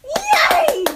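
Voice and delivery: very high, loud yay; high loud excited squeal; squealing cheer. Pretend. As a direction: squeal with joy. Sudden cheer.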